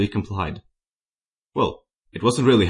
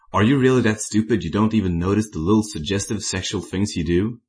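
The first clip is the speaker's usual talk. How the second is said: plain reading voice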